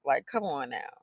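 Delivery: ordinary delivery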